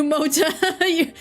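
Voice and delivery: ordinary talk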